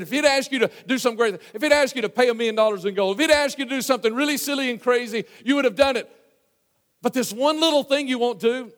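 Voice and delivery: high, insistent pleading with the master; acted-out exasperated reasoning, pitched high; urgent persuasion. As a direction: press the point harder with each clause. Servants pressing Naaman, acted.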